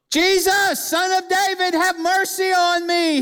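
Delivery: high, sustained cry for mercy. Retold or imitated. Blind beggar pleading to Jesus for mercy, crying out. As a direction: call out high, stretching each phrase. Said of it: "he cries out all the more".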